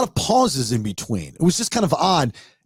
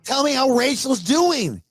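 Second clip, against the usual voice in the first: high-pitched, dragged-out mock slur; slurring mother; 10 semitones higher than usual. Mother answering her son on the phone, slurring. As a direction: imitate a woozy mother, pitched well up. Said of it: "She's slurring her speech".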